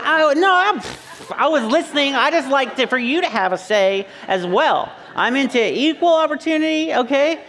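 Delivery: high, flustered mock protest; hammed-up wriggling out of trouble; defensive husband. Acted husband caught not listening.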